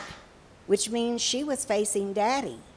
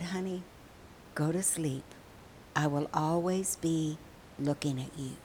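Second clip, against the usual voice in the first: low, very soft, near-whisper; soothing father; 4 semitones lower, 8 dB quieter than usual. Acted. Father promising he will always be looking, soothing. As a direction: lull a child to sleep, hushed.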